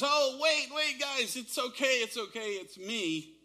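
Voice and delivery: high, loud, urgent reassurance that fades as he calms them; calling out reassuringly. Acted. Jesus calling to the frightened disciples across the water, high and reassuring. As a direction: call out to calm panicked friends, then settle.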